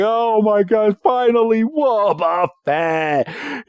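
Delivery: high, drawn-out mock crying voice; crying Wobbuffet. Wobbuffet pops back in, high mock-tearful wail. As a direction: wail it high, slowing at the end. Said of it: "he's crying. He's like".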